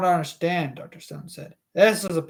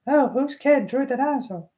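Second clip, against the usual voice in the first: higher woman's voice for the line; female voice; 7 semitones higher than usual. Shown as a demonstration. Reader settling on the female voice for Alice. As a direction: lift the pitch into a woman's voice. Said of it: "some sort of female voice".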